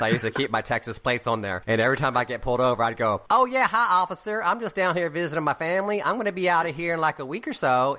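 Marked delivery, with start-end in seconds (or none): 3.3-8.0 high, overly friendly voice talking his way out of a ticket; innocent act for the cop. Retold or imitated. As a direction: play the harmless visitor, lightly hammed up. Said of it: "I used to do this".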